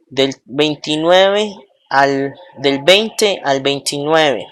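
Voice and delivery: ordinary talk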